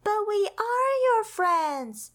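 high, slow, kind Millie voice; reassuring Millie. Acted. Millie reassures sad Pip, slow and kind. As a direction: gently reassure a sad friend. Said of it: "said Millie".

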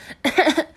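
high short cough; child cough. A narrator's reading. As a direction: cough like a sick kid. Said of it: "的咳起嗽来". (小孩咳嗽声)

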